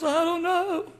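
high mock-weepy wail; crying wife. Wife on the couch answering her husband, mock crying. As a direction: imitate a wife whining through tears. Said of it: "crying, and the fellow says".

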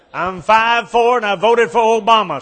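high, loud airhead answer; ditzy blonde pilot. Acted. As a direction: chirp it out proudly and clueless.

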